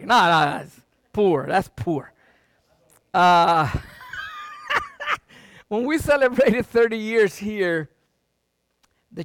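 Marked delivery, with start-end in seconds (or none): 4.1-5.2 short high-pitched laugh. Real, not acted. Laughs at own confession.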